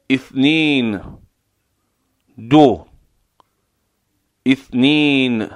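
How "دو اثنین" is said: ordinary delivery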